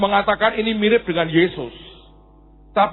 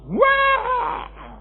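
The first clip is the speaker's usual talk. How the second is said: put-on high child wail; mock a child's screaming tantrum; anak merengek. Anak menangis minta sesuatu. (tangisan anak yang dibuat-buat, melengking tinggi)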